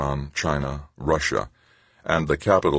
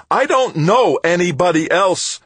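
high, loud desperate cry; cried out; 8 dB louder than usual. A narrator's reading. Tavalera crying out to Stoner in despair. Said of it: "Tavalera cried".

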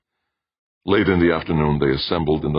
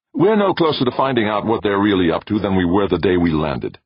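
loud, irritated complaint, raised pitch; angry growl; 5 semitones higher, 5 dB louder than usual. A narrator's reading. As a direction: growl it out in frustration, loudly. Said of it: "Brandon growled".

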